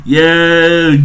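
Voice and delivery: can't believe what just happened; excited disbelief. Real, not acted. Fan reacting to Jinbei's entrance, laughing in excited disbelief.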